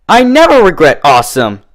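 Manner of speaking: loud, high boast; cocky drunk. A narrator's reading. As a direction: brush off the warning brashly. Drunk Dash defiant.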